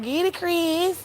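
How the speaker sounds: put-on very high, drawn-out baby voice. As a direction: squeak it out slowly like a baby. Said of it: "The voice change, baby voice".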